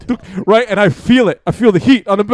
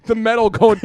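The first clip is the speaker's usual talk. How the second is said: ordinary delivery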